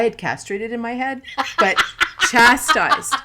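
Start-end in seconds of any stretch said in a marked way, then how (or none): 1.6-3.1 louder, through laughter; real giggles under the words; laughing. Real, not acted. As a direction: laugh while correcting your own slip. Cracking up over wrong word.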